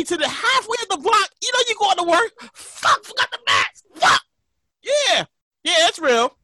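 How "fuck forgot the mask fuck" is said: high-pitched exasperated cursing; acted outburst of annoyance; frustrated realisation. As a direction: curse as if you just remembered mid-errand. Acting out realising the mask is forgotten.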